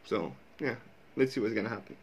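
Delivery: ordinary talk, nothing marked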